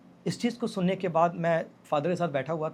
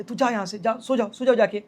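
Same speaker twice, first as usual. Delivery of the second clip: imitated mother's telling-off, higher and louder; retold scolding, insistent and raised; strict scolding; 5 semitones higher, 5 dB louder than usual. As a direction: order the child off to bed like a strict mum. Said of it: "तो वो बहुत strictly डांट रही थी".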